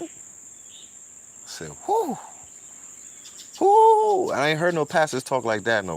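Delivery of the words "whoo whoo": very high, loud falsetto whoo; amazed whoo. Real, not acted. Viewer whoops at the pastor's bold words, very high and loud.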